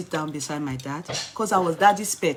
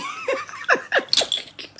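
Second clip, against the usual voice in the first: high laugh. Real, not acted. Laugh while retelling.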